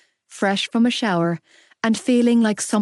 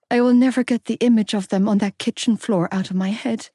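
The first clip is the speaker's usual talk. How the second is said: ordinary delivery, nothing marked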